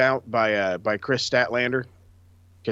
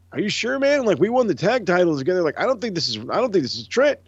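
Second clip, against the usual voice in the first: higher, fast, pleading mock disbelief; doubtful buddy; 7 semitones higher, faster than usual. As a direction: sound like a friend who won't be fooled. Voicing Rocky doubting fake Trent.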